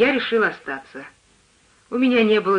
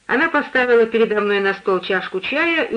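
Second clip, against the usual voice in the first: ordinary delivery, nothing marked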